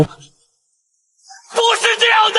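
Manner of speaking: very high, drawn-out outcry; desperate denial. Acted. The noble protests the scolding, drawn-out high cry. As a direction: stretch it out in a high, anguished cry.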